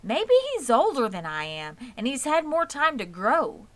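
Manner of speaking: hope aloud like an anxious little pig; Wilbur's hopeful voice. A narrator's reading. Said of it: "suggested Wilbur".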